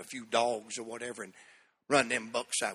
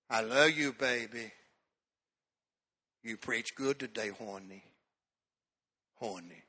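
mama's tender tone; 4 semitones lower than usual. Retold or imitated. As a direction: draw it out sweetly like a doting mama. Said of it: "talks to me in that tone".

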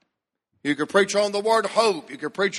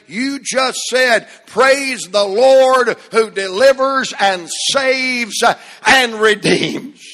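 full-force preaching; 3 semitones higher, 5 dB louder than usual. Real, not acted. Pastor stirring the congregation on Palm Sunday, preaching hard. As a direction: build the church up to praise.

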